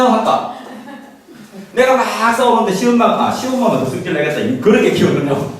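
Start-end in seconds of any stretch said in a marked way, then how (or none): none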